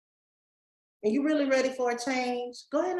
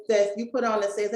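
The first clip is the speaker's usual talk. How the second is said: ordinary talk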